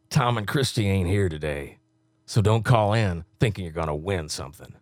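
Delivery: low, punchy promo read; low-pitched radio imaging voice; radio announcer. Acted. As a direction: deliver it like a station liner. Records promo liners in announcer voice.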